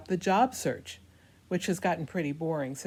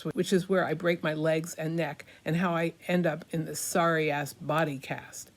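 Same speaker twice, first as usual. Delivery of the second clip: ordinary talk, nothing marked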